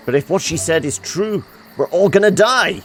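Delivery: rising to a loud, high alarmed cry; hammed-up panic that everyone dies; mock catastrophizing. Character panics about doom.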